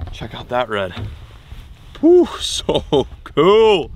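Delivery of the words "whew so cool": slow, loud, high whew with a laugh; loud laughing exhale of joy; breathless delight. Real, not acted. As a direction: savour the thrill, laughing through it. Thrilled after catching red.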